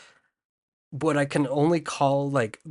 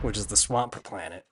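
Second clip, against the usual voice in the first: ordinary talk, nothing marked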